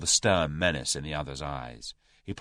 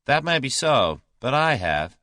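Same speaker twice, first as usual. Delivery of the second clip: dry, louder American comeback; 8 semitones higher, 6 dB louder than usual. A narrator's reading. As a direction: counter him firmly and dryly. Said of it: "said Julius dryly".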